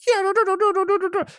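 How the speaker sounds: very high, loud rapid no no no; mock tantrum. Angry partner refusing, very high and loud. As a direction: wail like a refusing child. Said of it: "And you're like".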